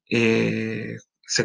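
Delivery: normal talking voice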